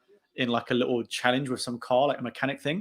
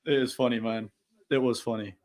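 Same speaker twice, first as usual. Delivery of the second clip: usual speaking voice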